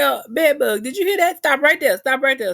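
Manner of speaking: high, loud excited call; urgent high calling out. Retold or imitated. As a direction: shout to her partner to stop the screen. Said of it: "I say".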